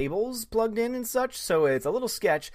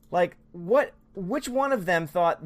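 ordinary delivery

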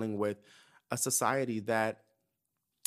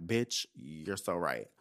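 slow realization; 6 semitones lower, 4 dB quieter, slower than usual. Retold or imitated. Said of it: "I was like".

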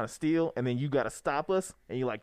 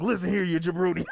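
wrestling promo; 3 semitones higher, 4 dB louder than usual. Acted. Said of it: "You gotta do the voice".

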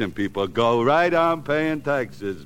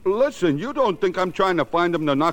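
defensive protest; 7 semitones higher than usual. Acted. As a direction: rush to deny it, rattled. Parolee protests he's no killer.

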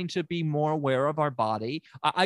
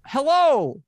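high, loud welcoming call; high-pitched shouted welcome, easing at the end; loud greeting; 14 semitones higher, 6 dB louder than usual. Acted. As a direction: shout a big hello to a visitor. Calling a client inside.

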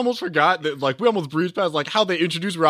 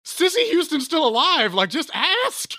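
exasperated; 12 semitones higher than usual. Real, not acted. Exasperated complaint about movie.

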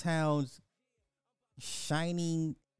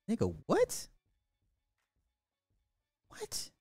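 incredulous; 10 semitones higher, 3 dB quieter than usual. Real, not acted. Outraged at the [name] claim.

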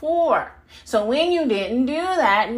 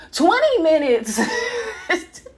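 laughing; 8 semitones higher than usual. Real, not acted. Laughing through protest.

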